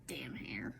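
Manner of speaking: muttered under the breath; whispered curse. Real, not acted. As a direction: grumble it in a whisper.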